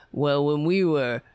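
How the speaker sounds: low, pompous, self-important drone; mock blowhard congressman. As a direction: lecture like a puffed-up veteran politician.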